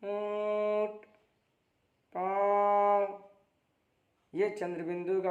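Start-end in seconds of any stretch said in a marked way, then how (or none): 0.0-3.3 ऊंट and पाँव very slow, the vowel nasalised and held; nasal held demo. Shown as a demonstration. Teacher shows chandrabindu words, drawn-out nasal vowels. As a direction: let the chandrabindu nasal of पाँव ring through the nose. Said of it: "नाक में आवाज़ ले जाकर एक second तक ठहरना है".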